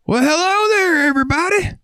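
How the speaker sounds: very high, cartoonish "Well, hello there"; goofy customer voice. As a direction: greet the whole store like a silly cartoon. Odd customer entering store.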